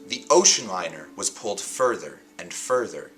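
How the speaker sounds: hushed storyteller drawing listeners in; dramatic story reading. Acted. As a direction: read these like mysterious story openings. Reads book captions dramatically.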